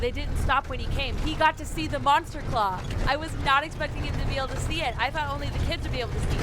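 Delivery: fast, eager reaction to the scene; excited commentary. Real, not acted. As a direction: rattle it off, excited. Reader's own commentary.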